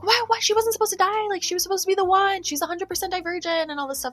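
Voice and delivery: high, frantic panicked villain voice; Janine freaking out. Retold or imitated. Janine panicking over the dead test subject, high frantic voice. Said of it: "like, freaking out".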